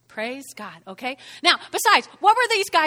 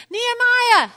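high, loud mock holler; calling out; 10 semitones higher, 9 dB louder than usual. Opponents hailing Nehemiah up on the wall, calling out. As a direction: call up to someone on a wall.

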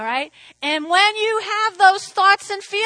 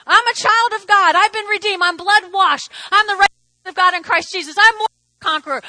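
louder, rising declarations of faith; loud proclamation; 2 semitones higher, 6 dB louder, faster than usual. Real, not acted. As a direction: preach it out with fire. Preacher proclaiming identity in Christ.

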